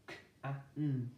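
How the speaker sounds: K-a-n, letter sounds spoken apart; sounding out. Shown as a demonstration. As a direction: spell out "Can" sound by sound. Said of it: "Sound this word out".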